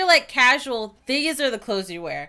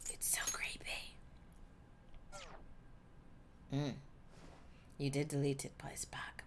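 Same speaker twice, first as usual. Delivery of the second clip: soft, unvoiced spooked whisper; creepy whisper; 7 semitones lower, 18 dB quieter than usual. Real, not acted. Spooked by the watching app, a creepy whisper.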